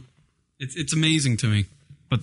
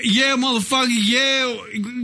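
high, drawn-out rapper impression; exaggerated rapper slur, stumbling for words; 6 semitones higher, slower than usual. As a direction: put on a turned-up rap persona. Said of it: "would he be talking like that?".

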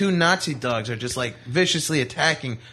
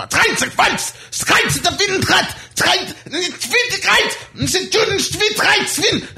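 screaming fake German; much slower than usual. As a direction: bark gibberish German at the dog like a drill sergeant. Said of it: "I screamed at it in fake German".

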